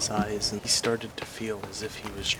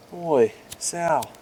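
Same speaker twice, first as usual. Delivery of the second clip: higher and louder, startled; startled question; 5 semitones higher, 4 dB louder than usual. Acted. Richard asking Sal about the light, startled. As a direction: point out the strange light, unsure.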